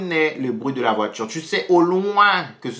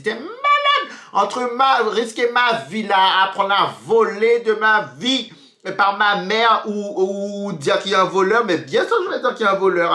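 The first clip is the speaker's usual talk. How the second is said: indignation; 7 semitones higher than usual. Retold or imitated. S'indigne contre le garçon.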